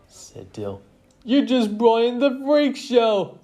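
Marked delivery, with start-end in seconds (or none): 1.2-3.4 high, loud mockery; jeering. A narrator's reading. Player ridiculing the new members, high loud jeer.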